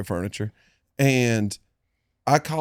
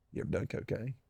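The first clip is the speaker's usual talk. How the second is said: very soft, secretive undertone; low-volume aside behind her back; hushed confidential question; 3 semitones lower, 16 dB quieter than usual. Real, not acted. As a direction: drop right down so she can't overhear. Daughter told to plug her ears.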